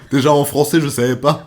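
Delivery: laughing loudly through the words. Real, not acted. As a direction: laugh while you say it. Laughing at own story.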